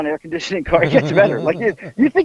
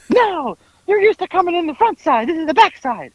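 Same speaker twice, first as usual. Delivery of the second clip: high-pitched indignant imitation; mock-exasperated insistence, pitched way up; mock arguing; 10 semitones higher than usual. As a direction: argue back in a squawky put-on voice. Imitating driver arguing back.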